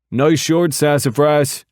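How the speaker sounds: loud, high playful call; teasing holler. Acted. Biker hollering a cheeky tease across the crash, loud. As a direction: shout the tease across the wreck. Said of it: "he hollers".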